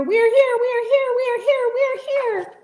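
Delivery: high, loud desperate shouts; tiny folk cry out to be heard; crying out in fear. A narrator's reading. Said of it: "they cried out in fear".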